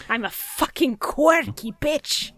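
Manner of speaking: slow, trilled R on quirky; rolled r. Acted. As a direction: roll the R in quirky. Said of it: "I'm quirky".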